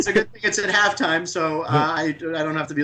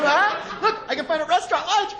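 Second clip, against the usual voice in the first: high, excited iPhone-obsessed friend showing off apps; a friend bragging about his new phone, high and eager; iPhone bro; 9 semitones higher than usual. Retold or imitated. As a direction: gush like a gadget bro. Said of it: "they come up and be like".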